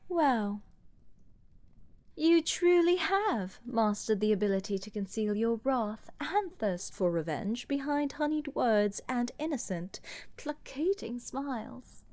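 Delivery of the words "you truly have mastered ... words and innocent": ordinary talk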